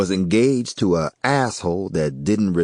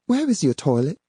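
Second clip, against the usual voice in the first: higher woman's voice; Yvonne's voice; 10 semitones higher, faster than usual. A narrator's reading. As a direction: read her lines a bit higher.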